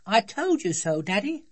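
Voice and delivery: put-on high, loud child's voice; childish I-was-right; smug little daughter. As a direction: crow triumphantly as a small girl. Child gloats to her father.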